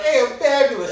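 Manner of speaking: loud, high-pitched mock greeting; guy walking in. Co-host mimicking how some guy walked in, loud showy greeting. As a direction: imitate a showy entrance at full volume. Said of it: "some guy walked in and was like".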